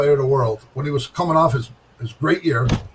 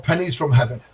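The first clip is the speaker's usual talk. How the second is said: ordinary delivery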